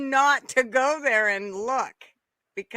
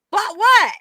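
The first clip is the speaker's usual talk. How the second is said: high, loud "Bought what?" in mock shock; startled question; 11 semitones higher, 11 dB louder than usual. Husband asking his wife what was bought, surprised and loud. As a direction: act out his surprise, pitch jumping up. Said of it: "And he's like".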